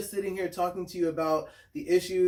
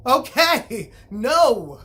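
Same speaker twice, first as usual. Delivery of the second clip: loud, firm "Okay, no."; emphatic refusal; 5 semitones higher, 10 dB louder, much slower than usual. Real, not acted. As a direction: shut the idea down hard. Rejects straight men's assumption.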